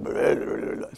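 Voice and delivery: low mumbled mock grumble; grumbling teenager. Teen daughter answering mom's hello, grumbling. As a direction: imitate a teen's wordless grumble. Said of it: "she grumbled something".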